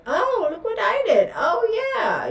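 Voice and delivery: high, smug mock-politician voice. Mocking politicians who brag about their deeds, high smug voice. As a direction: preen like a politician bragging. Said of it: "It's like".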